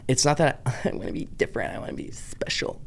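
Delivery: breathy unvoiced half-whisper, a bit fast. Real, not acted. Vlogger to viewers, admitting what she wants, whispered. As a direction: let the confession slip out as a whisper.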